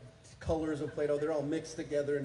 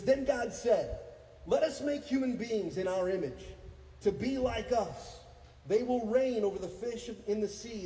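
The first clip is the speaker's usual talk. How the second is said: raised, ringing reading to a congregation; scripture proclamation; 7 semitones higher than usual. Real, not acted. Preacher reading Genesis to the church, proclaiming. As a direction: proclaim the verse with force. Reads scripture aloud at full voice.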